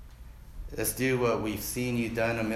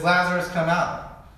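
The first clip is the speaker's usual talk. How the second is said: loud commanding call into the tomb; Jesus shouting at the tomb; 7 semitones higher, 6 dB louder than usual. Retold or imitated. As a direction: call Lazarus out with authority. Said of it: "he says".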